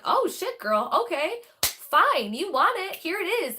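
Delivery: high, loud, cheeky spirit voice; spirit answering. Retold or imitated. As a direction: voice the spirit giving in with sass. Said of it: "And then they're like".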